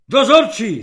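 high, loud bark of a summons; hoarse shout. A narrator's reading. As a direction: bellow for the duty man. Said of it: "zachrčel na dokonale zblblého". (chraptivý výkřik)

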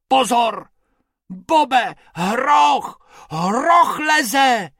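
high alarmed shout. Acted. Bobek warning Bob of a climbing hippo, shouting. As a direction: yell a danger warning to a friend. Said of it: "Křičel Bobek".

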